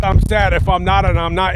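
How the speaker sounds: ordinary talk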